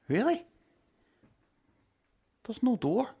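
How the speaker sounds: high, rising disbelief; incredulous questioning. Acted. Preacher voicing a doubter questioning the shepherd, incredulous. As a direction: pitch leaps up in mock astonishment. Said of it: "And you're like".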